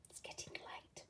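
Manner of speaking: breathy aside whisper; hushed late-night whisper. Real, not acted. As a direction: lean in and whisper it.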